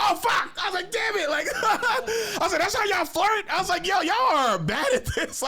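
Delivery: high, loud exasperated laughing; squeaky frustrated Damn it; mock dismay. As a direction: yelp it up high, half laughing.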